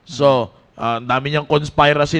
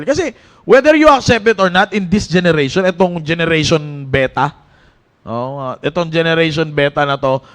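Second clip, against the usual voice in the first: raised, urgent preaching to the youth; 4 semitones higher, 4 dB louder than usual. Acted. Youth pastor exhorting Team Focus, preaching with force. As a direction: drive it home to the young people.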